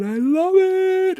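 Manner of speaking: squeaky high, slow and loud; mock delight. A dad faking delight at a drawing, squeaky high. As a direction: stretch it out in a falsetto squeal. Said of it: "And you're like".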